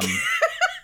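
squealing giggle; high-pitched laugh. Real, not acted. Laughs at own burping.

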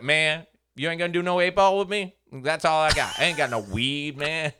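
loud high disappointed plea; drunk complaint. Retold or imitated. Drunk friend loudly pleading with the stranger. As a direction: whine it out loudly. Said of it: "he's like".

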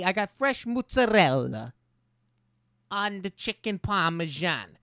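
mock South Shore accent, lightly put on. Retelling a restaurant coworker, in a light South Shore accent. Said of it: "the most nasally South Shore voice".

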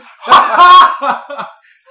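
real, high burst of laughter; loud laugh. Real, not acted. Storyteller cracks up at his own punchline, laughing loudly.

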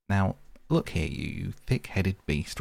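mild angry rebuke. Acted. Rat rebukes the stubborn mole, mildly angry. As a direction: tell him off. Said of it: "really angry".

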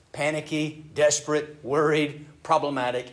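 lightly voiced anxiety; mock panicky. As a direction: act the panic as you list it. Describing panic words.

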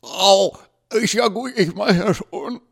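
high loud strangled yelp; pained yelp. Acted. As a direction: squeal while being choked. Choked by Lucy.

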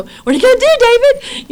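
high, loud mock alarm; joking panic. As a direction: squeal it as a running joke. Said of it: "we kinda have a joke".

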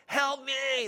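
high, drawn-out mock cry; imitated yell for help. Kidnapped kid in a movie, mock yelling for help. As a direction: mimic a trapped kid yelling without full volume. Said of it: "He's yelling out".

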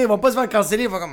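ordinary talk, nothing marked

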